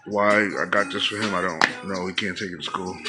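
ordinary delivery

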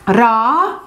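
راحت said "raa-hat" with a rising end; repeated model word. Shown as a demonstration. Teacher giving learners a third take of راحت. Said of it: "So راح becomes".

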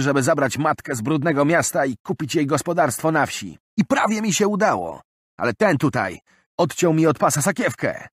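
high, loud desperate pleading; distressed robbed man. Acted. Bill telling the crowd his savings were stolen, desperate. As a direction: pour out the loss in anguish.

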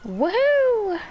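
high happy whoop; excited whoop. Real, not acted. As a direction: cheer on the jump. Jumping in game.

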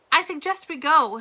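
pressing and brisk; a touch of urgency, get him out now; urgent. A narrator's reading. Lawyer urges Stanley to leave camp, mildly urgent. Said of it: "with a sense of urgency in her voice".

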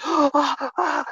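high, panicky mock gasps; imitated hyperventilating. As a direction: gasp like a hyperventilating child. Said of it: "she's hyperventilating".

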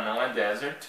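ordinary talk

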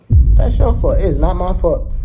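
loud, high-pitched insistence; emphatic blaming. Real, not acted. Vlogger blaming ex for the breakup, loud and emphatic. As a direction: throw the blame back, loudly.

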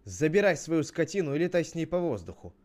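loud, high storybook yell; acted shout. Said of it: "Один кричит".

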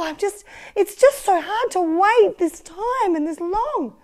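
high, eager waiting disciples; excited disciples. Pretend. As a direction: play the eager disciples, impatient.

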